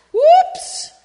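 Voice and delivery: very high, loud whoops; big whoops. Acted. Reading to kids, a very high loud whoops. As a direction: yelp as he falls. Boy loses grip.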